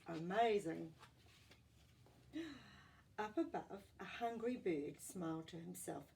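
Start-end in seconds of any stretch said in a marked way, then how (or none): none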